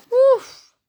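very high, loud yelp; snowball-hit oof. A narrator's reading.

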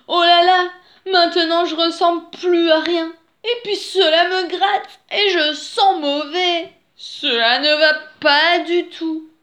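high, loud lament; sighing dismay. A narrator's reading. Wolf caked in itchy mud, loud lamenting dismay. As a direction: moan it out, fed up. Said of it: "et soupira".

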